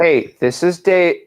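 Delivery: slow word-by-word dictation; dictating. Shown as a demonstration. Coach restarting the script for trainee, slow dictation. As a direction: spell it out slowly for writing. We're gonna write it.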